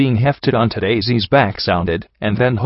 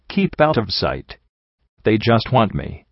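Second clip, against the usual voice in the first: ordinary delivery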